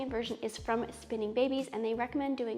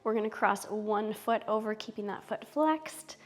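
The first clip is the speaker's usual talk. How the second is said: soothing yoga teacher; 6 dB quieter, slower than usual. Acted. Guides yoga poses slowly.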